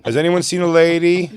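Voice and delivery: high, loud searching call. Acted. Security guy searching for a woman, calling out. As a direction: call out across the distance, stretched. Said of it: "Cut to, like, a security guy".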